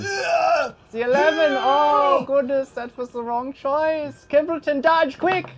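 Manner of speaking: high, loud, drawn-out cry; dramatic high-pitched game master yell; urgent shouted warning. Acted. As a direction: shout the alarm as danger flies at the player. Game master calls out sudden danger.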